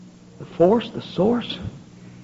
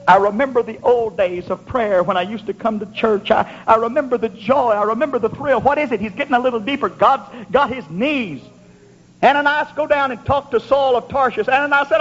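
raised, driving revival sermon delivery; fervent preaching; 9 semitones higher than usual. Real, not acted. Preacher stirring the congregation with memories of prayer, fervent. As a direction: preach it with rising fervor.

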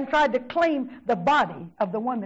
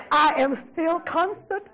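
higher, insistent testifying cadence; rising preaching; 6 semitones higher than usual. Real, not acted. Preacher relaying God's answer to her problems, rising fervour. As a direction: build with each repeated statement.

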